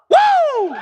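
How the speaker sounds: very high, loud amazed whoop; Jesus marveling. Acted. Preacher voicing Jesus marveling at faith, an amazed whoop. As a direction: let out a long whoop of astonishment. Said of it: "And Jesus said".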